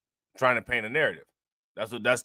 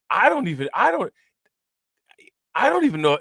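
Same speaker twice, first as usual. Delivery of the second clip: high-pitched, baffled false starts; baffled disbelief; 7 semitones higher, 4 dB louder than usual. Real, not acted. Host stumped by a reporter's accent, high baffled stammer. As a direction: break off, voice jumping up in disbelief.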